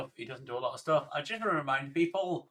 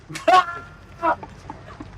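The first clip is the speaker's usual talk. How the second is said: loud high laugh; 14 semitones higher, 5 dB louder than usual. Real, not acted. Streamer bursting out laughing at Bush's golf line. Reacting to "now watch this drive.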